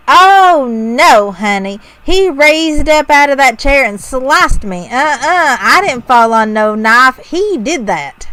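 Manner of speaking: put-on Southern accent, indignant and sassy. Podcast host voicing the angry neighbor in Southern accent. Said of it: "in my best Southern accent".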